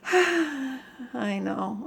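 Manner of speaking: usual speaking voice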